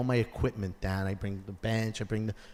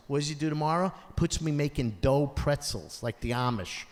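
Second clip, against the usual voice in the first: ordinary talk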